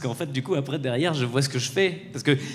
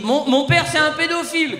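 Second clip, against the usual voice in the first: put-on high, giddy kid blurting nonsense; hammed-up drugged child babbling; mock giddy child; 11 semitones higher, 5 dB louder than usual. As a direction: play the kid high on laughing gas. Son high on gas, imagined.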